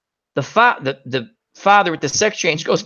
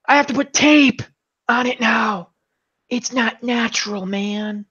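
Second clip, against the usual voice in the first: mock-distressed gripe; acted upset whine; 5 semitones higher than usual. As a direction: complain in a high voice, losing steam. Acted complaint after ripped page.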